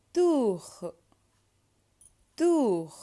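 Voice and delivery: "Tour" with a stretched French oo; oo vowel demo. Shown as a demonstration. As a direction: model "Tour" as toor, high and loud. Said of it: "oo sound".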